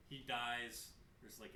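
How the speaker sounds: ordinary talk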